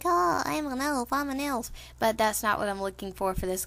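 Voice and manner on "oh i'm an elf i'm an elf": lightly voiced, slightly higher nasal elf; a hint of a prissy fantasy elf; mock nasally elf. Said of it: "people usually think elves have some kind of nasally voice".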